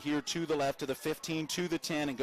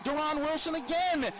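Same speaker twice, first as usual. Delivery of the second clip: excited shout; 8 semitones higher than usual. Real, not acted. Big sack called in play-by-play.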